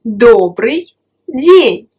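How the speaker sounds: Добрый день said slowly and clearly, syllable by syllable; Добрый день drawn out for the learner to copy; slow model phrase. Shown as a demonstration. A teacher repeats Добрый день slowly for the student.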